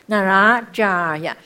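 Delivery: 扎尔 held with a trailing ah; 那拉扎尔呀 with 扎尔 lengthened; long vowel. Shown as a demonstration. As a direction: let an ah tail out of 扎尔 before 呀. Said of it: "所以尾巴一个啊出来了啊".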